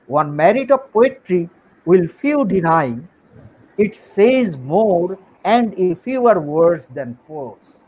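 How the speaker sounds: very slow, measured English recitation; drawn-out words with weighty pauses; recited quotation. Acted. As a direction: quote it deliberately, phrase by phrase.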